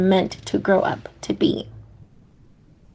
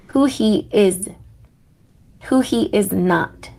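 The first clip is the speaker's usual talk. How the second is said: normal talking voice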